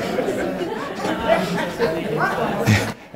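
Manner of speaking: airy, high-pitched chuckle; high breathy laugh. Real, not acted. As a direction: let out a silent wheezing laugh. Laughs at own story.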